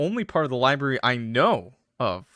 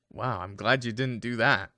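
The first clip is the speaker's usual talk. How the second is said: normal talking voice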